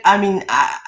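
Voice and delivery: ordinary delivery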